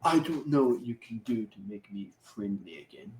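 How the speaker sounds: low, soft put-on voice; softly mimicked grudging refusal; mock unreasonable friend. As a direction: quietly imitate someone being difficult. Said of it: "being unreasonably".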